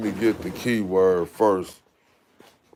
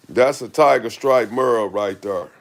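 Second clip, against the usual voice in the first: ordinary talk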